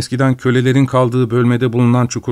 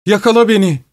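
very high, triumphant cry; high shriek-like call; 10 semitones higher than usual. A narrator's reading. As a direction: dare them to chase you, high-pitched. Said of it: "diye haykırdı".

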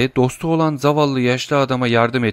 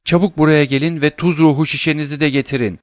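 urgent shout; 5 semitones higher, 4 dB louder than usual. Acted. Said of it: "diye bağırdı".

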